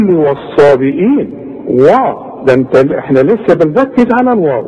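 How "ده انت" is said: usual speaking voice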